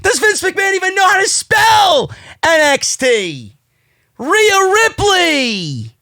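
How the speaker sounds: high-pitched, drawn-out incredulous shouting; outraged rant. Real, not acted. Host scoffing at McMahon knowing NXT, outraged. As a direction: cry out in exasperated disbelief.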